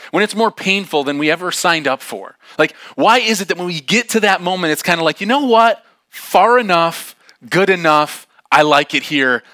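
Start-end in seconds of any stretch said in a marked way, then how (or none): 5.2-9.4 loud, pitched-up declaration; emphatic quitting. Pretend. Preacher voicing our urge to quit when hurting, emphatic. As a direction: declare it in separate, decisive bursts.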